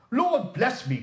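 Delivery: high, loud startled exclamation; startled cry. A narrator's reading. Old gentleman crying out at Scrooge's whispered gift, startled. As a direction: cry out in sudden shock. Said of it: "cried the gentleman".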